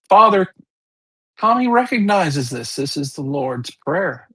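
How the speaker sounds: normal talking voice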